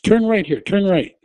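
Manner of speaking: high, loud retold order; urgent command. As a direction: snap the direction out fast. Directing dazed driver urgently.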